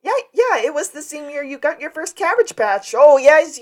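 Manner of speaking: high, loud acted eagerness; excited recollection. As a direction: play a burst of delighted remembering.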